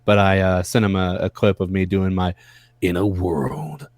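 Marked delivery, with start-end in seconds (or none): 2.8-3.9 movie trailer voice. Shown as a demonstration. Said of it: "that type of voice".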